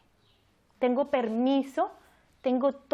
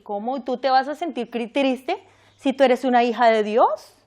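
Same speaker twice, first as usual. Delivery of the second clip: mock scolding; 8 dB louder than usual. Mocking judgmental churchgoer's reproach.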